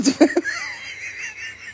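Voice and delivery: a shrill burst of laughter; high shrieking laugh. Real, not acted. Woman laughing at her own story, high shriek.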